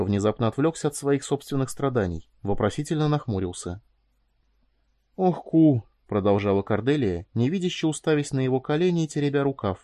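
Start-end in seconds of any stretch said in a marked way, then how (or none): 5.1-5.8 high, louder, emotional exclamation; heartfelt plea to a friend; pained sympathy. A narrator's reading. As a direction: sigh the name out with feeling. Cordelia sighs at Koudelka in sympathy.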